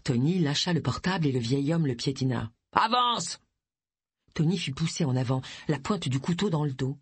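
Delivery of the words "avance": high, forceful order; sudden angry command; harsh command. Acted. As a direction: order the captive to move, pitched up. Gunman ordering captive forward.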